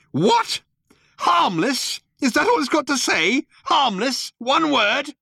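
Arthur shrieks in outrage; outraged disbelief. A narrator's reading.

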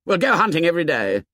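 high, fast eager boast; excited boast. A narrator's reading. Jack boasting to Ralph about daily hunting. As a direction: gush about the hunt.

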